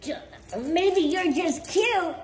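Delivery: gushing singsong adoration; cooing pet talk. Real, not acted. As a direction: coo slowly and high at a pet.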